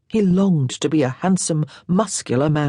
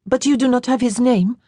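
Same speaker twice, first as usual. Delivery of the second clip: put-on light, higher girlish voice; a lightly voiced young lady, wistful; young Frenchwoman, a bit higher and brighter; young French girl; 8 semitones higher, 3 dB louder than usual. A narrator's reading. Girl asks.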